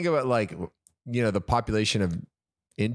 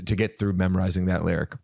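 normal talking voice

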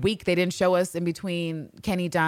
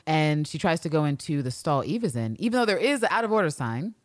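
usual speaking voice

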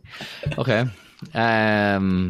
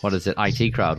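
usual speaking voice

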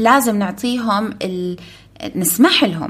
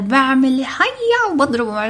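put-on little ninja boy; 4 semitones higher, slower than usual. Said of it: "فايت مود النينجا والكاراتيه".

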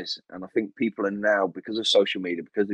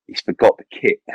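punchline collapsing into a high laugh; real helpless giggle at the end; breaking into laughter; 6 semitones higher, 4 dB louder than usual. Real, not acted. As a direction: crack up as the punchline lands. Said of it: "I shouldn't laugh".

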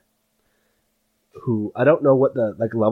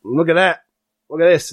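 put-on eager kid pointing, louder and higher; excited; 6 semitones higher, 5 dB louder than usual. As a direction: show it off with delight. GM voices excited NPC.

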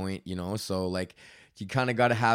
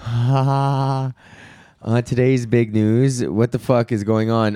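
louder hammed-up anchor read, slow at first; mock newscaster; 9 dB louder, slower than usual. Host reading his joke news segment, hammed-up anchor voice. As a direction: play the news anchor for laughs. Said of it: "Time for big news with [name]".

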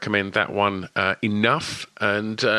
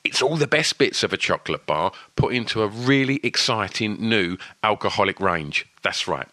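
upbeat, higher sponsor announcement; excited ad read; 4 semitones higher than usual. Acted. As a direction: sell it like big news. Host reads sponsor ad.

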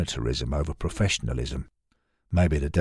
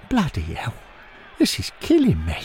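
frustrated outburst; 5 semitones higher than usual. Acted. Stan's frustrated outburst at match.

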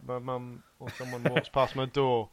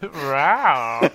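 roar; 7 dB louder than usual. Acted. Copies partner's roar.

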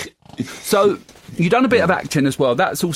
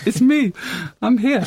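a hint of Spanish accent, higher; mock foreign accent; 8 semitones higher, slower than usual. Host announcing himself as a character, lightly Spanish accent. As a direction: keep the hammed-up Spanish accent. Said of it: "bit Spanish accent".

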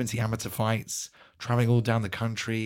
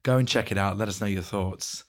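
usual speaking voice

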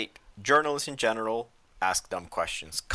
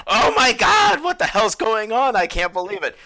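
much higher, louder mock panic; hammed-up disbelieving yelp; retold friend's shocked outburst, high and loud; 10 semitones higher, 9 dB louder than usual.

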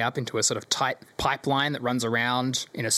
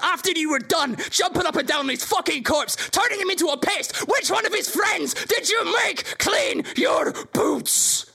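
furious shout. Acted. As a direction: scream it at your sister. Villain brother shouts accusation.

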